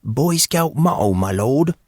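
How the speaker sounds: playful mock British accent. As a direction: put on a posh British accent. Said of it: "said Casey in his best British accent".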